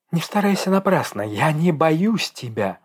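high, trembling defiance. A narrator's reading. Dasha claims not to fear Egor, trembling voice. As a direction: defy him while hiding fear. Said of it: "произнесла она дрожащим голосом".

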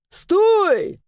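high loud shout after her. Acted. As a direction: yell at someone running off. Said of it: "закричал он ей вслед".

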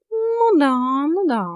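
high, loud, mocking cat voice; sceptical cat. A narrator's reading. Cat doubts heroine's excuse, sceptical mock agreement.

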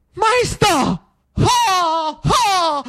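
high, drawn-out desperate call; crying out. A narrator's reading. Salvatore clinging to the carriage before the king, high cry. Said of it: "con la faccia del re davanti gridò".

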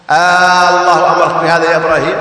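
"آه، الله" opened with a long sung "aa" as a question; questioning long hamza. Shown as a demonstration. Teacher illustrating the interrogative to students, first vowel held long. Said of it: "استفهام".

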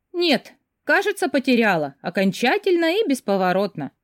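lightly amused, drawing out the first words; wry amusement. A narrator's reading. Karina answers a man about lost keys, wry amusement. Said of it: "усмехнулась Карина".

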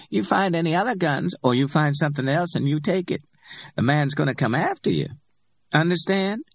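Simone's voice, warning. A narrator's reading. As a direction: raise the voice and lay down the warning. Simone warns Max.

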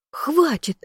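high shout of enough. A narrator's reading. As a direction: yell it over the brawl. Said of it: "вновь повысила я голос".

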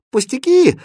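high, loud brush-off; breezy dismissal. A narrator's reading. Princess waving away her governess, dismissive. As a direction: toss it off in a high voice. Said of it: "отмахнулась от неё принцесса".

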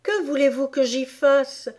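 high, protesting question; protesting Alice. Acted. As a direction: answer back in a high voice. Alice answers the Dormouse back.

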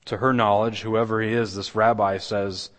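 ordinary talk, nothing marked

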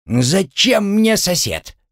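high, slow grumbling objection; displeased. Acted. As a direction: object in a high, drawn-out whine. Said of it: "от этой новости поморщился". (недовольно, высоко и протяжно)

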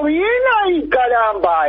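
high, mock-enthusiastic "bien ahí"; hammed-up fake praise; ironic approval. Ironic reply to colleague's plan. (aprobación irónica en tono agudo)

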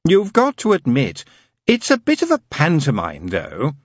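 a demon poking fun, wry; knowing demon. Acted. Demon mocking God's garden to the angel, wry.

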